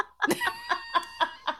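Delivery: squealing high laugh; high shrieking giggle. Real, not acted. Laughs at own joke.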